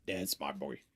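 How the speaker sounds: deep, hushed Henry impression; low, quiet mock of Henry praising his dog; imitating Henry. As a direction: drop low and murmur it like Henry. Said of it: "it's the funniest way he says it".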